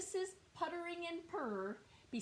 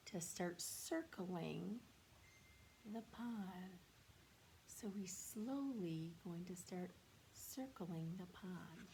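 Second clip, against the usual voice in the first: slow soft read; 3 semitones lower, 8 dB quieter, slower than usual. Shown as a demonstration. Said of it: "slow soft demonstration".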